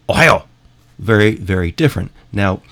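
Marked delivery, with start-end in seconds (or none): 0.1-0.5 "ohayo" said higher and louder, bright Japanese greeting. Shown as a demonstration. Contrasting Japanese with Chinese for viewers, higher. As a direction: pop out "ohayo" high and loud. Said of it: "Japanese, for example, would be".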